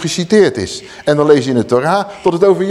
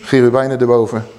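usual speaking voice

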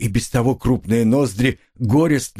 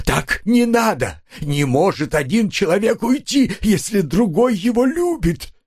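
exclaiming; 10 semitones higher than usual. A narrator's reading. Husband protests his wife leaving for another, wailing exclamation. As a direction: cry it out, pitched well up. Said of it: "воскликнул Лоханкин".

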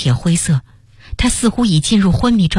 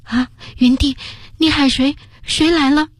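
startled pleading; 9 semitones higher than usual. Acted. Narrator startled, asks dying boy.

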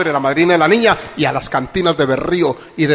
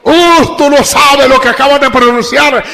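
higher, louder warning call; challenging shout; 8 semitones higher, 7 dB louder than usual. Retold or imitated. Pastor warning the mother about her vow, loud and high. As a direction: warn her boldly from the pulpit.

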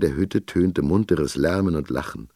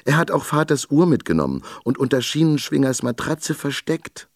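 put-on much higher small girl voice; young girl's voice; 9 semitones higher than usual. A narrator's reading. Girl tells the captain about the watch, as a child. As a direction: speak up brightly as a little girl. Torilda speaks.

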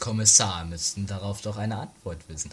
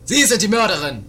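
high, loud accusing shout; shouted accusation; 11 semitones higher, 10 dB louder than usual. Acted. Sergeant suddenly shouts the accusation at the maid. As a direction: hurl the accusation at her.

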